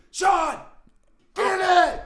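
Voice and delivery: hyping up a teammate with loud yells; military yelling. Retold or imitated. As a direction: holler at him to hype him up. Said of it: "start yelling military shit".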